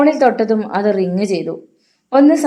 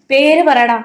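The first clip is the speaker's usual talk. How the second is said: louder, higher demand; angry shout; 6 semitones higher, 6 dB louder, slower than usual. A narrator's reading. Hari demands the caller's name, angry shout. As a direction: bark the order at him.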